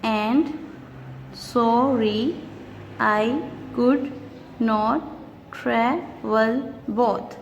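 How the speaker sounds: slow, every syllable pulled apart. Shown as a demonstration. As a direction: spell out each beat of the line slowly. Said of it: "Let's see how".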